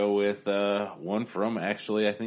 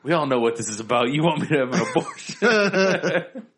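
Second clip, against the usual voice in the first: movie quote voice; 6 semitones higher, 6 dB louder than usual. Retold or imitated. Quoting movie line.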